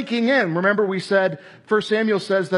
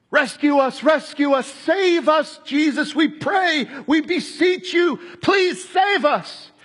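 pleading crowd; 8 semitones higher than usual. Retold or imitated. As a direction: beg to be saved, crying out.

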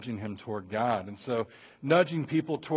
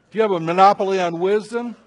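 loud, quick, raised challenge; Eliphaz retorting; 4 semitones higher, 11 dB louder, faster than usual. Acted. Eliphaz fighting with Job, loud challenge. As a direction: fire the question back hard. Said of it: "Eliphaz responds".

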